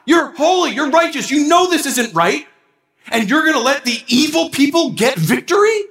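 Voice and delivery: high, pleading protest to God; pleading Habakkuk. Retold or imitated. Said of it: "That's what Habakkuk is feeling".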